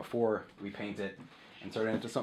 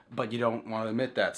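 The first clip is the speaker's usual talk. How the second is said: ordinary delivery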